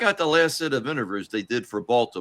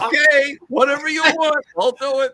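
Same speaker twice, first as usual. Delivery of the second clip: much higher, louder delighted voice; imitating Vince; 12 semitones higher, 5 dB louder than usual. Vince gleefully agreeing, much higher and louder. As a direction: gush like a thrilled boss. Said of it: "Vince is almost jerking off".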